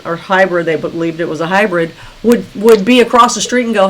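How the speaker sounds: ordinary talk, nothing marked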